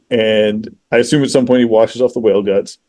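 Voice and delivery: normal talking voice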